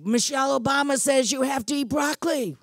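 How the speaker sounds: high mocking parody voice; mocking quote. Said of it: "And so they say".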